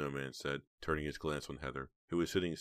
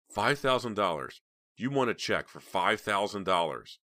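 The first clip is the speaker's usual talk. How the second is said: higher, louder incredulous questioning; shocked disbelief; 5 semitones higher, 7 dB louder than usual. A narrator's reading. Heather balks at the blackmail price, incredulous. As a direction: repeat the sum, aghast.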